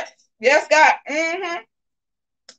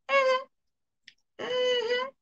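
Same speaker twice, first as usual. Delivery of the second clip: high, soft, drawn-out mm-hmm; sassy knowing hum; 7 dB quieter than usual. Real, not acted. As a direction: hum it like you know the tea.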